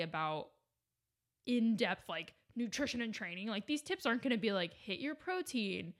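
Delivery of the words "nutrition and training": ordinary delivery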